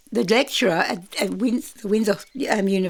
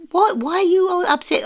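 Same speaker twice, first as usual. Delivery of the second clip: high, raised retold question; imitating a teacher's startled appeal; acted concerned demand; 11 semitones higher, 5 dB louder than usual. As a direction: call out over an upset class, acted. Acted question to upset class.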